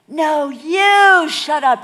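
very loud, high, drawn-out yell; shouted retort. Retold or imitated. Pastor retorting to a departing biker, escalating shout. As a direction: top the other shout, leaning long on YOU. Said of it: "I retort".

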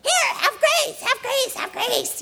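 very high, loud frantic giving; manic high voice. Retold or imitated. Wife throwing grace messages at family, shrill and high. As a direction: toss the words out like confetti. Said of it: "my voice is just like that".

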